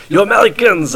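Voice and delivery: high-pitched mock foreigner, vaguely French; botched attempt at a French-sounding accent; unidentifiable foreign accent. Imagined local scolding a football-loving American, muddled foreign accent. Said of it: "I don't know what country that is. It sounded French".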